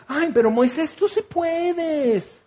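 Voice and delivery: high, drawn-out cooing cheer; syrupy, stretched-out pep talk; mock gushing encouragement. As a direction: coddle someone in parody. Mock encouragement God never says.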